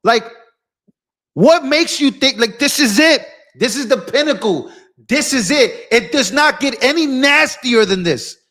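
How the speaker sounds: heated, high-pitched rant; failing to calm down, voice climbing; pissed off. Real, not acted. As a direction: stretch the question in real exasperation. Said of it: "I'm getting pissed off".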